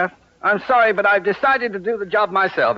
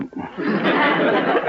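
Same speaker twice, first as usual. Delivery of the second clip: a short airy laugh, caught out; sheepish laugh; 9 semitones higher than usual. Acted. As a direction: laugh it away, a bit embarrassed.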